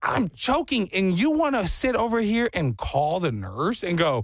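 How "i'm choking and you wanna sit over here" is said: high, incredulous wait what; mock disbelief. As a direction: play the outraged choking kid. Acting out the choking kid.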